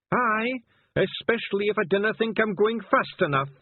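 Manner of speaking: high, loud Scottish voice, slowed right down; a gruff little Feegle in disguise barking orders; high Scots wee man. Acted. Feegle passenger voice.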